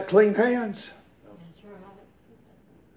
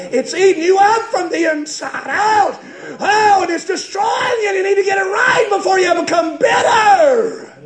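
high, driving, shouted revival preaching; full-force preaching; 11 semitones higher, 4 dB louder than usual. Real, not acted. Preacher retells a father and son making up to the congregation, full-force preaching. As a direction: preach it at a sustained high pitch, punching phrases.

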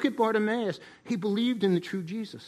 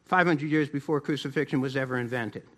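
ordinary talk, nothing marked

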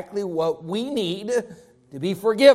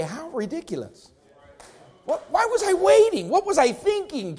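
mock disbelief; 8 semitones higher than usual. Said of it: "look back at the excuses".

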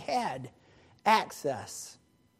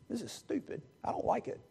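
sulky mutter; 4 semitones higher, 11 dB quieter, faster than usual. Acted. Sulking son outside the party.

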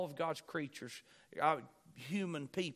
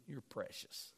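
dry mocking "You're precious", much softer; sarcastic; 5 semitones lower, 10 dB quieter, slower than usual. Shown as a demonstration. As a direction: sneer it like a backhanded compliment.

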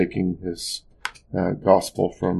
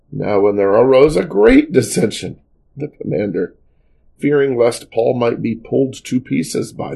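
emphatic, animated Bible reading aloud; scripture reading; 4 dB louder than usual. A narrator's reading. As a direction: read the passage out to the congregation with lifted key words. Reads a Bible passage aloud.

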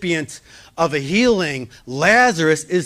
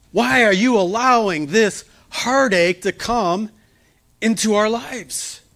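grieving sisters; 6 semitones higher, 3 dB louder than usual. Acted. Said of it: "the sisters say".

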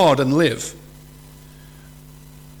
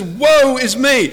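high, slow, loud lament; mock terror; 7 semitones higher, 5 dB louder, slower than usual. Isaiah seeing God in a vision, acted terrified cry. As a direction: wail it slowly as a terrified prophet, lightly acted. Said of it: "He's absolutely terrified".

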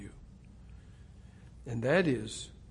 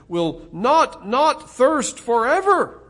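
second not much higher and louder, slow; emphatic not, not; 9 semitones higher, 11 dB louder, slower than usual. Shown as a demonstration. Preacher stressing the double negative, louder and higher. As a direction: punch not, not thirst forever. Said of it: "only perhaps even more emphatically".

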